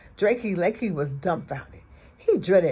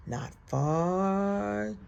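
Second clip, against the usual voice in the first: sly fox; 4 dB quieter, much slower than usual. A narrator's reading. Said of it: "said Foxy Loxy".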